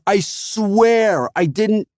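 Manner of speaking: high, slow, rising plea; drawn-out plea. A narrator's reading. Scared teen begs the customer to believe him, drawn out. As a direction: stretch out the oath begging. Panicked kid apologizing.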